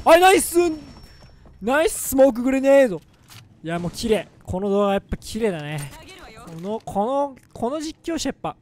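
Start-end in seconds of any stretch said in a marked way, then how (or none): 0.0-3.0 ナイススモークグレネード called out loud and bright, stress on ナイス; excited callout. Real, not acted. Praising a teammate's smoke grenade, an excited ナイススモークグレネード. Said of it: "スモークグレネードナイス！みたいになる".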